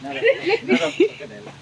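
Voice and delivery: laughing. Real, not acted. Laughing at a mistaken animal.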